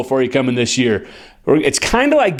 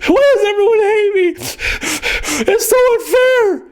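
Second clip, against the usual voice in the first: much higher, whiny mock sob; mock crying whine. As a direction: whimper like a sulking crybaby quarterback. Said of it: "I think at halftime he was crying".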